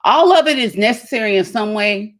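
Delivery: ordinary delivery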